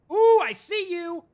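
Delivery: high, loud taunting ooh; spotted you, loud and delighted; excited. Real, not acted. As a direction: gleefully call out the spotted enemy. Spotting the enemy ship.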